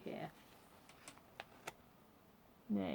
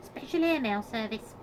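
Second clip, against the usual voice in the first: loud, gruff announcement; gruff Duchess; 3 semitones higher, 6 dB louder than usual. A narrator's reading. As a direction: growl it out as the duchess's rough voice. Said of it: "said the Duchess gruffly".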